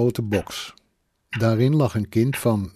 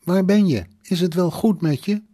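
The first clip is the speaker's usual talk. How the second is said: high, pressing questions; anxious questioning; 8 semitones higher, 4 dB louder than usual. A narrator's reading. Worried mother phones her son, anxious questioning. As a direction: press for an answer, pitched up.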